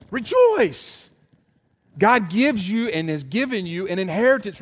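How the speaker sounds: high, ringing preacher's refrain, each rejoice thrown out; preaching at full force. Acted. Preacher urging the congregation to rejoice in troubles, full force. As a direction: build the sermon's climax with every rejoice.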